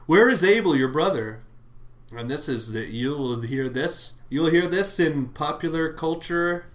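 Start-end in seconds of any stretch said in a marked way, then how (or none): 0.1-1.4 high, loud demanding call; booming God. Retold or imitated. The Lord demanding Cain say where Abel is, loud and high. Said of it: "The Lord said to Cain".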